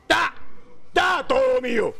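triumphant high yell; excited shout. Real, not acted. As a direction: shout the win. Gaming kill celebration.

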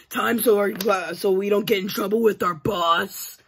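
ordinary talk

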